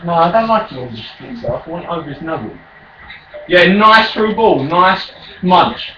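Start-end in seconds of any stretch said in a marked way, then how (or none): 0.0-2.6 silly put-on voice. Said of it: "Do that voice again".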